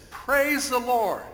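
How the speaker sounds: high, loud, drawn-out cry of praise; exultant shout. Acted. Lady finding groceries on her porch, exultant shout. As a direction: shout your thanks slowly to heaven. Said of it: "and shout".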